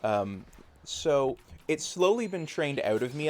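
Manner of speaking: ordinary talk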